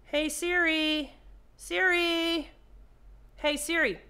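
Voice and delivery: slow loud summons; calling out. Real, not acted. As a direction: call Siri across the room. Calling out to Siri.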